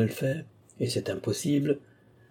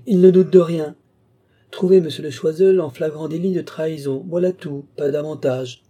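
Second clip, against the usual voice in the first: loud, high, amused outburst; exclamation; 5 semitones higher, 7 dB louder than usual. A narrator's reading. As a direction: exclaim at full voice, mocking the boast. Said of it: "s'écria-t-il". (s'écrier fort, d'une voix haute et amusée)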